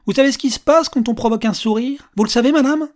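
high, loud, eager questioning; excited old man posing a riddle; voice up in pitch. A narrator's reading. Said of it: "La voix du vieux monsieur avait grappillé quelques octaves".

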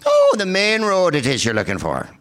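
hammed-up Irish brogue, high and loud; Irish accent. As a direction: sing it back as a question, Irish style. Irish local answering with question.

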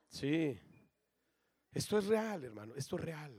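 ordinary talk

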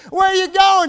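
high, loud mock shout; calling after someone. Wife calls to her fleeing husband, high loud shout. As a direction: imitate a wife yelling down the road.

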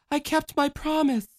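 put-on girl asking Ma Marie, softly; little girl, softly. A narrator's reading. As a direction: ask softly like a small girl. Michelle speaks.

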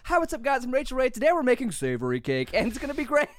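high, loud, bubbly TV host voice; Rachael Ray voice. Retold or imitated. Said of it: "her voice keeps changing when she says it".

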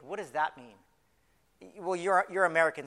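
ordinary delivery

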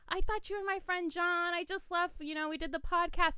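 high-pitched retold excuse; flustered explanation. Woman explaining the mix-up to the caller, flustered explanation.

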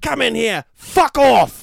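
high, loud furious yell; angry boss. Pretend. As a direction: scream it like a raging chef. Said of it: "Is the sergeant played by Gordon Ramsay?".

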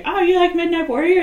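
put-on a touch of a gushing girl. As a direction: ask with a mock thrilled lift. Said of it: "is like".

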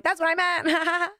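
high, drawn-out fake-cheerful squeal; panicked cover-up. Comic retelling a flubbed TV line, panicked cover-up. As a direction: bluff through it, high and stretched out.

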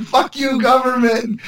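high-pitched mocking rebel voice; mock defiance. Mocking protesters talking to the government, mock defiant.

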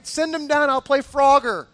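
high-pitched playful bravado; gung-ho excitement. Retold or imitated.

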